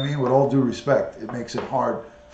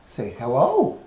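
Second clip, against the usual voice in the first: coaxing pet voice; slower than usual. Acted. Coaxing parrot to talk on camera.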